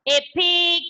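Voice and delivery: usual speaking voice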